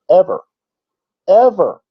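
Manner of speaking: EVER hit high and loud, each one on its own; loud, high-pitched EVER, set apart by pauses; emphatic insistence. Real, not acted. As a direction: punch "ever" as a final, absolute word. Emphatic denial of W sound.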